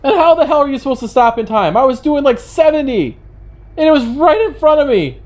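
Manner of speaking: high-pitched, exasperated self-defence; indignant protest. Real, not acted. Protesting the unavoidable bunny crash.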